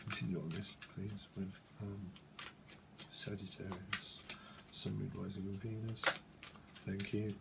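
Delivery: very low, hushed murmur; quiet private invocation, dropped in pitch; murmured request. Real, not acted. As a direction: ask softly under the breath. Murmured aside request while handling cards.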